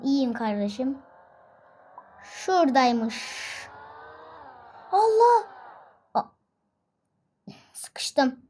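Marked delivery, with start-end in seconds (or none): 4.9-6.2 high loud startled cry. Real, not acted. Gamer crashes the taxi, crying out startled. Car gets stuck suddenly. 7.7-8.4 whispered to himself. Real, not acted. Gamer stuck in the taxi, whispering to himself. Stuck car, muttered to self.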